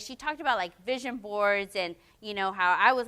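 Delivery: ordinary talk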